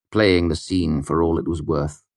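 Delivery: usual speaking voice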